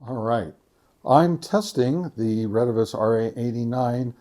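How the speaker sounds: ordinary talk, nothing marked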